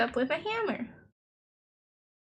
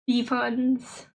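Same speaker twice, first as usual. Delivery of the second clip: ordinary talk, nothing marked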